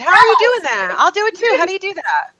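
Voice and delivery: high, loud, delighted outburst; real excitement. Real, not acted. Co-host learns of the Facebook Live stream, excited. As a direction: gush with eager, high-pitched curiosity.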